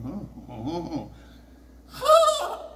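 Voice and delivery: high, bleating oh oh like a lamb calling; a loud high-pitched lamb bleat; imitated sheep noise. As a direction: bleat it out like the ram's call. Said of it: "I make the noise that he makes".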